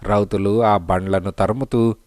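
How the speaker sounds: plain reading voice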